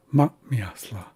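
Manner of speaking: "ma, miasla" with a brief held breath after "ma"; glottalized M. Shown as a demonstration. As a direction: cut the airflow after "ma", then say "miasla". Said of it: "you hold your breath very briefly".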